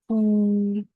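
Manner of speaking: "pou" high, long and level; sustained, unbending high "pou"; first tone. Shown as a demonstration. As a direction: hold "pou" on one high note. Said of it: "le ton constant, le premier ton".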